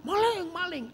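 high mock yell of maling; mock thief alarm. Preacher mocks an ambassador crying thief, high mock yell. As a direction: imitate a panicked cry of "Maling, maling". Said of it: "awas kamu teriak".

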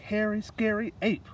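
usual speaking voice

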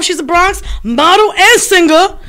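ordinary delivery

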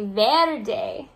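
verde stretched out slowly, VER-deh; drawn-out verde for little ones to copy; Spanish word verde. Shown as a demonstration. Teacher slowly sounding out verde for the class. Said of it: "Or in Spanish, we say".

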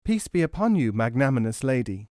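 plain reading voice

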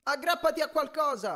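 high-pitched urgent call; strained high shout, held back in volume. A narrator's reading. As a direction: call an instruction across a distance. Calling to friend sliding away.

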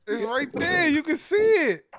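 high, laughing hard; cracking up while pointing it out. Real, not acted. Laughing at the clothes pile.

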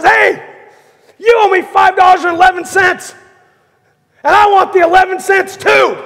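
high, loud furious shout for money; angry demand. Acted. Servant to his debtor, shouting angrily. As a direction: bark it at him, throttling the debtor.

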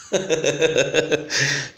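soft run of giggles; real chuckling laugh. Real, not acted. Finally found the word, giggling at himself. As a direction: laugh helplessly at your own slip.

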